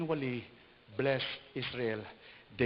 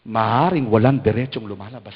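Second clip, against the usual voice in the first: much louder emphatic line; forceful preaching; 19 dB louder than usual. Real, not acted. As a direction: preach it out strongly. Preacher exhorting. (malakas na pangangaral)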